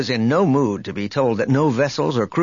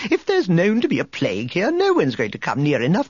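much higher, prissy droid protesting; fussy protocol droid; 11 semitones higher than usual. A narrator's reading. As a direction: protest like a nervous robot butler. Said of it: "Three P O protested".

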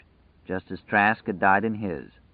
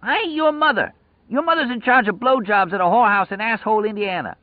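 high, loud raging insult; screamed high insults, fading at the end; furious yelling; 14 semitones higher, 3 dB louder than usual. Acted. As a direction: yell abuse at the madman. Lloyd yelling back at prisoner.

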